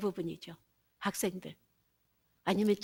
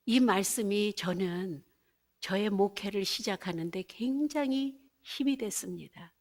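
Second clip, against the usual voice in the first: ordinary delivery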